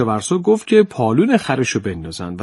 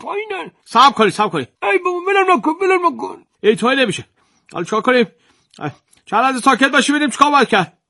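higher, flustered bossy landowner; fussy landowner flustered; 9 semitones higher than usual. Acted. Landowner flusters over the stuck jar, high and bossy. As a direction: fuss and bark orders while helping.

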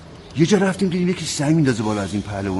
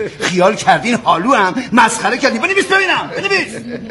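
indignant; 9 semitones higher, 3 dB louder than usual. Acted. Wrestler indignant at officer.

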